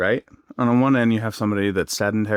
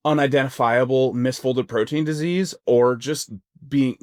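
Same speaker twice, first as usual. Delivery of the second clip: ordinary talk, nothing marked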